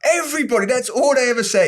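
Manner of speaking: high-pitched fed-up cry; comic exasperation. Real, not acted. Host fed up with every pundit's cliché, comic exasperation. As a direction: wail it in mock despair.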